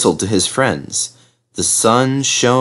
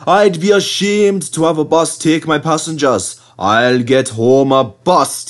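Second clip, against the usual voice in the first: put-on higher, determined old engine; brave promise; 7 semitones higher than usual. A narrator's reading. Skarloey refusing a bus, brave promise. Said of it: "he promised bravely".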